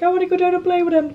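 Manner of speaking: high-pitched and eager; high excited inner voice. Retold or imitated. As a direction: squeal it like a kid. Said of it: "I'm like".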